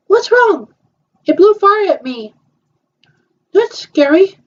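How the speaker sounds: high, alarmed play character; a doll startled by sparks; scared doll voice. Pretend.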